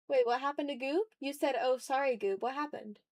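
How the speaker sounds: normal talking voice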